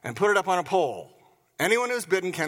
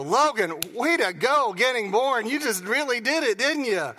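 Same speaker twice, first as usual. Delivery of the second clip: mock praise; 7 semitones higher than usual. Parents mock-cheering newborn Logan, hammed-up praise. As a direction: gush over the baby as a joke.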